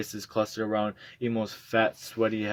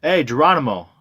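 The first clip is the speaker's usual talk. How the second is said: high, loud call through a door; retold yell; 6 semitones higher, 11 dB louder than usual. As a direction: shout it at the door. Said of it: "knocked on the door and yelled".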